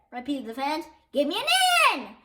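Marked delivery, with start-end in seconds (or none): 1.1-2.1 cheerleader shout. A narrator's reading. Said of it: "shouted the cheerleaders".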